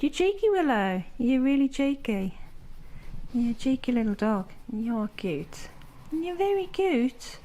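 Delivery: high, sing-song pet talk. Real, not acted. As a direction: coo at the little dog. Talking to her dog.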